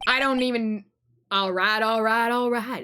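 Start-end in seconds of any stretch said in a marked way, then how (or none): 1.3-2.8 relaxed Southern drawl, catchphrase style; hammed-up movie-star drawl breaking into a laugh; Matthew McConaughey. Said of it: "That's all I know about Matthew McConaughey".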